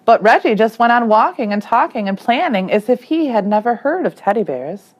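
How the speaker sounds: plain reading voice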